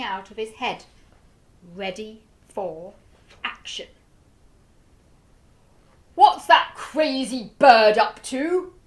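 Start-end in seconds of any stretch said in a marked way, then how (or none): 6.1-8.7 loud, high, slow bewildered shout; loud cry. A narrator's reading. Duke cries out at the pelican, loud. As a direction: call out in confusion. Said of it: "cried the Duke".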